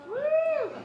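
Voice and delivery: very high, loud cheer; whoop of joy. Real, not acted. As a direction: let out a joyful whoop. Celebrates his grand plan.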